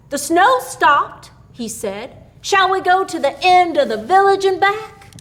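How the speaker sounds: James. A narrator's reading. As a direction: speak up brightly as the young man. James speaks to the other.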